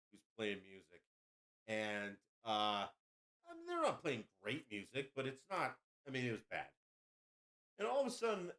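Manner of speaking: ordinary talk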